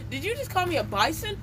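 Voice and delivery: teacher's mock outraged accusation rising high; indignant accusing question. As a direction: throw the accusation back at the kid, voice climbing.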